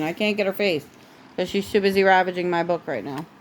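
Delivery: ordinary delivery, nothing marked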